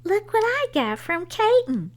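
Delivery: put-on high, loud, thrilled boy's cry; excited shout. A narrator's reading. Boy shows mom the invitation, shouting with excitement. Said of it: "shouted Henry".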